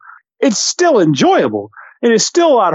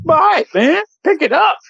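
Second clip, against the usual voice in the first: high playful voice; 10 semitones higher than usual. Acted.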